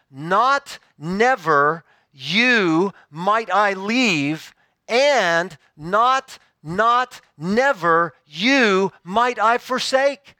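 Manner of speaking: very slow, each word weighted separately; spaced-out, deliberate stress on every negative; emphatic literal rendering. Shown as a demonstration. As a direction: hammer out "not", "never" and "you" one by one. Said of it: "It's emphatic".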